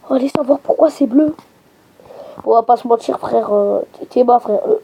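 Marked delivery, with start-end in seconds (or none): none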